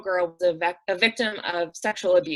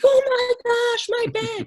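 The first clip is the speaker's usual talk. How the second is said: put-on high, slow, thrilled child's squeal; excited kid; 4 dB louder, slower than usual. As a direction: gush like a kid seeing her own bed. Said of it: "There's like".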